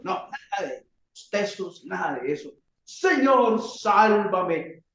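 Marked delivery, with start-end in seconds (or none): 2.8-4.8 heartfelt cry. Pretend. Peter calling on the Lord: heartfelt cry. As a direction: plead from the heart. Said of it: "así de corazón clamó al Señor".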